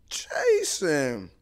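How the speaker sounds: high, loud tearful cry; crying out his name. Retold or imitated. Mom sobs his name seeing her son. Mom's tearful reaction in video.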